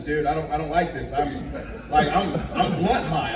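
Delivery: normal talking voice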